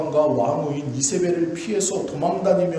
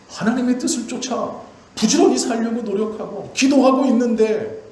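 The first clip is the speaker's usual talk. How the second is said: loud, high, pleading sermon cry; impassioned preaching; 7 semitones higher, 6 dB louder than usual. Acted. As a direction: preach it out as a desperate plea to God. Preaching at full force.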